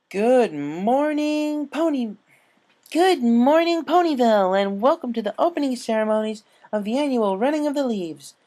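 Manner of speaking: excited dragon announcer; race announcer. Acted. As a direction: boom it out over the crowd.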